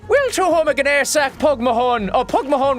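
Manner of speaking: hammed-up high stage-Irish voice; mock Irish accent. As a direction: play a cartoon Irishman. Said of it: "I come from Ireland".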